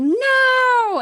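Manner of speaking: high, long, full-voiced cry; very loud yell. Player as elf seeing an ally stabbed, very loud yell. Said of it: "I yell very loudly".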